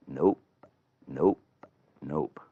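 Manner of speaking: low, short nopes with pauses; deadpan refusal. Acted. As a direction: refuse each time, unbothered and low. Baby refusing to nurse.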